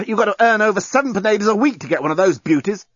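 high-pitched indignant protest; indignation. A narrator's reading. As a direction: protest as if thoroughly affronted. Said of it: "Dan had switched back to indignation mode".

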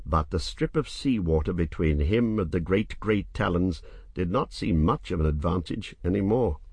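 ordinary delivery

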